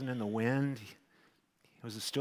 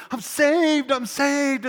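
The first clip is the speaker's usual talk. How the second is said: high, loud tearful cry of joy; joyful crying shout; 14 semitones higher, 11 dB louder, much slower than usual. Retold or imitated. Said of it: "I'm, I'm crying".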